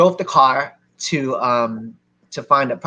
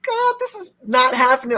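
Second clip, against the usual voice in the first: high, hushed disbelief; amazed. Retold or imitated. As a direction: squeal it under your breath, stunned. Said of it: "I felt so amazed right there".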